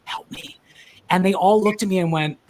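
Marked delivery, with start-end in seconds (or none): 0.1-0.6 breathless whispered plea. Retold or imitated. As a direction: whisper it off mic. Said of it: "I just went".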